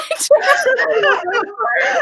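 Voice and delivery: high teary laugh; tearful laugh. Real, not acted. Host laughing and weeping at the guest's words, teary laugh. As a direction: laugh through the tears. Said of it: "now I've made [name] cry".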